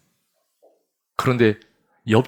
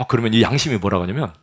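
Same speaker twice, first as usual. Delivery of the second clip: usual speaking voice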